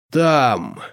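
drawn-out creature's pronouncement; strange creature. A narrator's reading. Creature pointing searchers to the garden, creature voice. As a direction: intone it like an odd monster. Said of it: "весьма странное существо".

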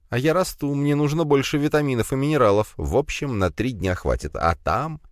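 put-on high, chirpy spider girl. A narrator's reading.

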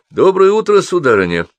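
high, loud opening, falling away; hearty greeting. A narrator's reading. Учитель встречает девочку у метро, hearty greeting. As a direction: hail her grandly from a distance.

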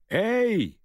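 high, loud shout of hey; calling out. Acted. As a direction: yell to stop him. Woman calls out to stranger.

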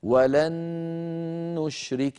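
"ولن نشرك" joined by one long humming n; doubled noon with ghunnah. Shown as a demonstration. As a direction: hold the n of "ولن" right into "نشرك". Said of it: "فأدغمنا النون الساكنة في النون المتحركة".

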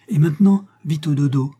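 put-on mother to child. A narrator's reading. Mother putting child to bed.

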